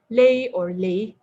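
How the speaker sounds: "lay or lay" with a changed tone on each "lay"; lay said in different tones. Shown as a demonstration. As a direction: say "lay" slowly, once per tone. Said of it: "The next example would be lay".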